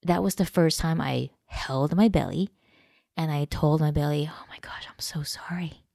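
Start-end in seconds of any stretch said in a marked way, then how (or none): 4.3-5.8 soft unvoiced whisper, very gentle; whispered apology. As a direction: breathe it tenderly to your own belly. Tenderly apologizing to her own belly.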